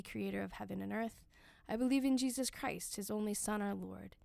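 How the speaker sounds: ordinary delivery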